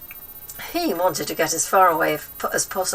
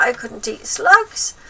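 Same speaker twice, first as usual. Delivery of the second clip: dismayed cry; 6 semitones higher, 8 dB louder, slower than usual. A narrator's reading. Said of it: "George cried out".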